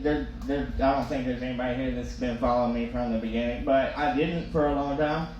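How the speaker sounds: ordinary delivery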